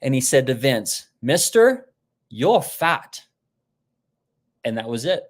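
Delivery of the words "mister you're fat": higher, louder imitation of a blunt acupuncturist; put-on voice, bluntly delivering a verdict nose to nose. Chinese acupuncturist to his patient face down on the table, blunt imitation. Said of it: "the Chinese acupuncturist is within inches".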